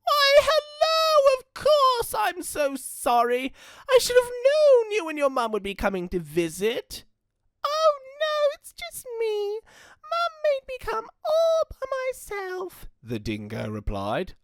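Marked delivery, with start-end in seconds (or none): 0.0-7.0 put-on apologetic old gran; fussy granny voice. Gran voice held. 7.6-12.9 much higher sweet child voice put on; wolf posing as a girl; fake little girl. Dingo faking Red.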